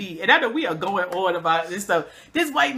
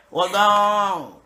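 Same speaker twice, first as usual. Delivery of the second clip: slow Southern man's drawl; 3 dB louder, much slower than usual. Retold or imitated. Southern neighbor greeting city girl, slow drawl. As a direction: drag it out like a country neighbor. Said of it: "he was like".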